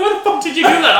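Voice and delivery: mock-scold him high. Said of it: "my girlfriend's like".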